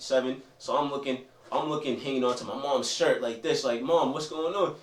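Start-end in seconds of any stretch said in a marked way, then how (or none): 3.8-4.8 put-on much higher, pleading kid's voice; scared child. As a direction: plead to mom like a frightened child. Child clinging to mom, confused.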